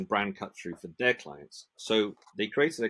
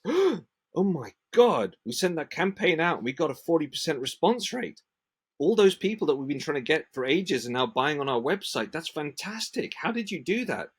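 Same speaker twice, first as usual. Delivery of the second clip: high, loud acted amazement; excited outburst, pitched way up; thrilled customer; 8 semitones higher, 5 dB louder than usual. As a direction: play a client bowled over by the results. Voices thrilled customer seeing results.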